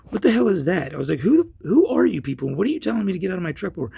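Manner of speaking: loud, high retold protest; indignant outburst. Man confronting strangers with odd badge, indignant. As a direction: demand answers in heated disbelief. Acted quarrel over unknown badge.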